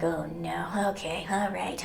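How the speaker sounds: soft, whispered reluctant okay; reluctant mutter. Pretend. Told to visit grandpa, a soft reluctant whisper. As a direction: grudgingly agree under your breath.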